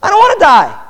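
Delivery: a condemned man begging for his life; dying thief pleading. Acted. Preacher voicing dying thief.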